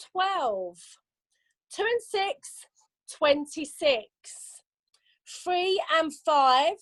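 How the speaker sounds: slow sing-song number calling; bingo caller. Acted. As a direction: call each number drawn out.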